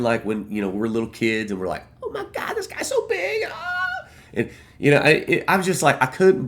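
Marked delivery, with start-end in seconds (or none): 2.0-3.4 put-on much higher, breathless kid voice gushing through a laugh; starstruck little kid. Fan recalls seeing his hero up close, squealing like a little kid. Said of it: "like a little kid seeing Hulk Hogan". 3.5-4.1 put-on high, laughing kid squeal; starstruck squeal. Fan recalls spotting his hero in the crowd, squealing with laughter. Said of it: "like a little kid seeing Hulk Hogan".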